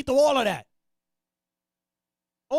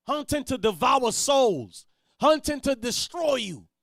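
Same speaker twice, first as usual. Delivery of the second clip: ordinary talk, nothing marked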